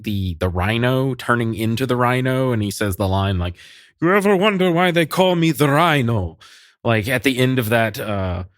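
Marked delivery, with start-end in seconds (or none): 4.0-6.4 lightly Italian-tinged tough guy quote; slight Italian accent, mock menace; accidental Italian accent. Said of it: "I sounded Italian".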